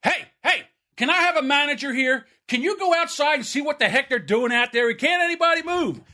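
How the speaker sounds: high-pitched mock complaint to a manager; irate customer. Angry shopper in the store asking for a manager, high mock complaint. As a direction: play the fed-up shopper demanding a manager. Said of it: "walk in and go".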